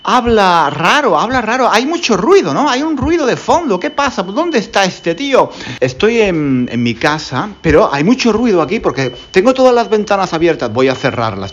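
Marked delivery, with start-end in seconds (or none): 0.0-5.5 higher, mock-baffled questions; puzzled listener. Imagined listener puzzling over the background noise, mock-baffled. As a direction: voice a confused listener wondering about the noise. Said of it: "algunos se estarán preguntando".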